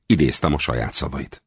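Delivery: usual speaking voice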